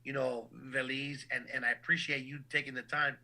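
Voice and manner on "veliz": Veliz with "veh-LEEZ", stretched out slowly; mispronunciation. Shown as a demonstration. Announcer showing another butchered take of his name. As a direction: slowly say Veliz as the wrong version. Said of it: "is mispronounced".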